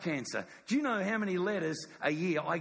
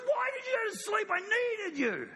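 much higher pained shout, slowing on the last words; anguished Jesus. Acted. Jesus waking his sleeping disciples, high hurt shout. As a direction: cry out in hurt at friends who fell asleep.

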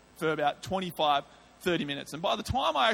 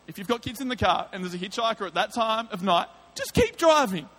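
impassioned preaching; 7 semitones higher, 5 dB louder than usual. Acted. Preacher warning the congregation that demons are real, fervent. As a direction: drive the point home to the congregation.

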